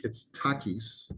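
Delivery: "Takis" said slowly, TAH-kees; slow name demo. Shown as a demonstration. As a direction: spell out "Takis" slow and clear. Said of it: "how the name is pronounced".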